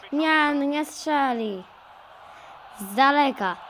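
ordinary delivery

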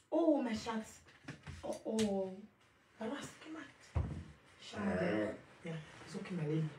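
calling softly from far across the room; coaxing a pet to come out, far from the mic. Real, not acted. Away from the phone, calling her pet shanks gently.

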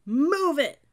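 very loud, high-pitched shout; yelled command. A narrator's reading. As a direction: yell it to get recruits moving. Cadet yelling at hesitating recruits.

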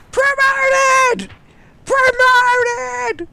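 very high, loud comic squawk; mock promotion yell. Voicing what a spotted player is thinking, high mock yell. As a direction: screech it like an over-the-top game celebration. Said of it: "why is everyone yelling when they get promoted".

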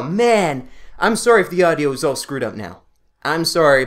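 ordinary talk, nothing marked

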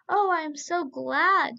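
overflowing happiness; glad. A narrator's reading. Slave girl rejoicing for the young man, gladly. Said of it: "said Hulin".

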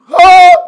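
very high, loud cheer; ecstatic high whoop. Retold or imitated. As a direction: let out a crowd's wild whoop. Crowd cheering for Buhari.

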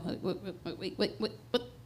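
stunned. Retold or imitated. Mother hearing the caseworker mention placement, stunned and soft. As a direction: caught off guard, barely getting words out.

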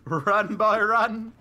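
loud urgent call; shouting. Real, not acted. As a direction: yell it out, urging. Urging dog to run.

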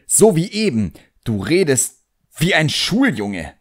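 put-on a proud young magician snapping back at his demon; the boy, irritated. A narrator's reading. Boy voiced in story.

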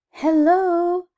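high, drawn-out loud hello; shouted greeting. A narrator's reading. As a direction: holler a long hello. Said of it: "shouted a tortoise".

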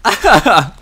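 high, loud burst of laughter. Real, not acted. Laughs during play.

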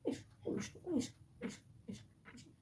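whispered coaxing. Acted. Mother-in-law whispering to coax the crying bride. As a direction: whisper it, barely a breath.

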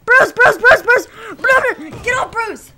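high, loud panicked warnings; frantic shouting. Real, not acted. Gamer yelling to in-game ally Bruce, frantic shouting. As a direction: yell it like his life depends on it.

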